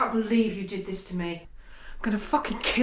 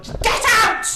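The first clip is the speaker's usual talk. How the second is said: higher, much louder scream of get out; furious shout; 12 semitones higher, 6 dB louder than usual. Acted. Betrayed fiancée screaming at Robert to leave. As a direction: scream him out the door.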